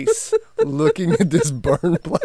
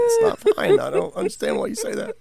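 much higher mock whine, then fast; a hammed-up bewildered child; whiny kid; faster than usual. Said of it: "they're just looking at the dumpster fire going".

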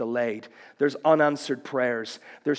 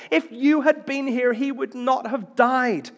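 grieving lament; 9 semitones higher, 5 dB louder than usual. Acted. Mary weeping at Jesus' feet, high grieving plea. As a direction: let it break into grief. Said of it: "weeps and sobs".

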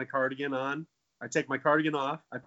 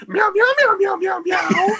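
loud, high squeaky puppet meows; cat puppet voice; 12 dB louder, slower than usual. Shown as a demonstration. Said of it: "hit me with that one more time".

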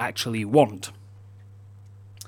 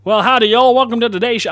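higher, hammed-up American country greeting; all-American accent; 4 semitones higher, faster than usual. Shown as a demonstration. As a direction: greet them in a big Southern American accent. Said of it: "being all American".

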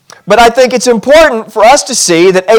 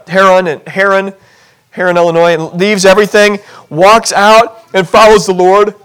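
usual speaking voice

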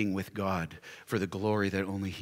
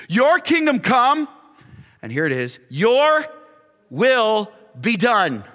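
slow, loud, rising pulpit cadence; fervent preaching; 9 semitones higher, 10 dB louder than usual. Acted. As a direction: proclaim each line to the congregation. Preaching the Lord's Prayer.

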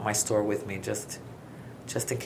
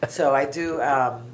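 usual speaking voice

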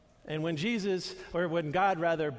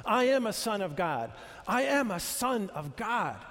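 declaring affirmation; 4 semitones higher than usual. Retold or imitated. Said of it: "saying them to themselves".

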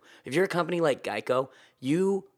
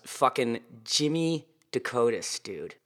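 ordinary delivery

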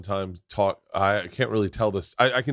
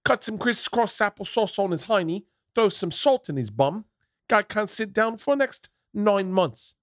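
much higher imitation Cockney storyteller; London gangster accent; 12 semitones higher than usual. Comedian finishes imitated gangster-dad story, high mock Cockney accent. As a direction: spin the tale in mock Cockney, high-pitched. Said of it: "I can't do the accent".

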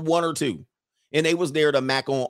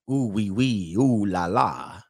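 drawn-out, lower mock French accent; 4 semitones lower, slower than usual. European men talking to your girls, mock French accent. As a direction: lightly put on a smooth French accent. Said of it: "they start talking that French bullshit".

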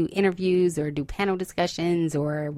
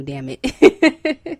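defiant; 6 semitones higher, 5 dB louder than usual. Real, not acted. Defiant about weight gain.

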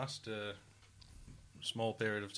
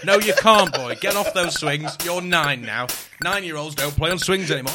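loud, firm dad telling off; hammed-up loud father; mock stern father; 4 semitones higher, 13 dB louder than usual. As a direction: shout down the boy like a strict dad. Father scolding the imagined son.

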